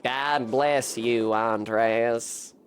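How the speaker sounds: slow, low character voice; Rudiger, grateful villager. Acted. Rudiger thanks Andreas for his silence, slow and low.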